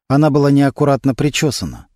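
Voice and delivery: plain reading voice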